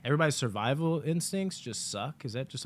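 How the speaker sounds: normal talking voice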